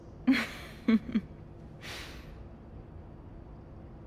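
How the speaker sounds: light, self-amused laugh; soft chuckle. Acted. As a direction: chuckle at your own boast. Laughs at her own boast.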